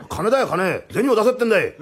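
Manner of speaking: loud, rising-pitched robber's demand; impatient demand. Acted. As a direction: press him hard for money. 泥棒が金を要求. (いら立って声高に要求)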